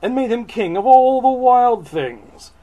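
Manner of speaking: high, loud, theatrical narration; booming picture-book climax; grand storybook reading. Acted. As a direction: proclaim it to an audience. Reads the book text aloud.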